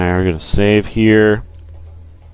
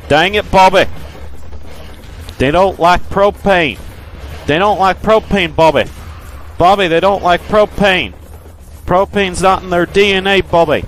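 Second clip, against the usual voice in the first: dad character voice; 7 semitones higher than usual. Retold or imitated. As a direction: scold Bobby like an exasperated cartoon dad. Gamer voices a drawling dad character.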